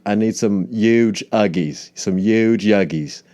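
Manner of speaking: "huge Yuggies" stretched slowly as yooj yuggies; yuge Yuggies. Retold or imitated. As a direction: drag out "huge" with no H. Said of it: "he's gonna be like".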